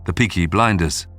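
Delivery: normal talking voice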